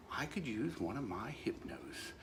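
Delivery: conspiratorial unvoiced whisper; whispered aside to the audience. Real, not acted. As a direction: let them in on a secret, breathily whispered. Sly aside about using it on wife.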